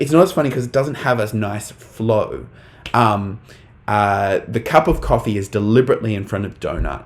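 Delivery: normal talking voice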